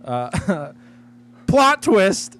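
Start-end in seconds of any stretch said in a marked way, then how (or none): 1.5-2.4 loud, high announcement on the edge of laughter; gleeful reveal. Real, not acted. Host revealing the plot twist, gleeful and loud. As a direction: belt out the big twist, amused.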